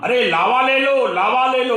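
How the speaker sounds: mock street hawker calling his wares; hawker's call. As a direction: call it out like a seller in the lane. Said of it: "चिल्लाने लगा".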